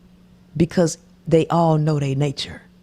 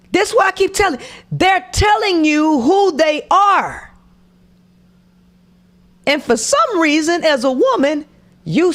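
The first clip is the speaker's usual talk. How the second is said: exasperated; 10 semitones higher than usual. Real, not acted. Exasperated rant.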